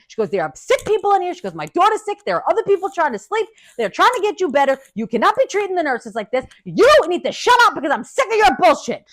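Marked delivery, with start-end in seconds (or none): 0.3-1.3 high-pitched indignant scolding; mother yelling. Retold or imitated. Mother scolds noisy patient, indignant yelling. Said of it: "my mother yelled at the lady". 1.5-9.1 high, angry tirade; mother yelling. Retold or imitated. Mother berates patient for disturbing the sick, angry yelling. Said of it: "my mother yelled at the lady".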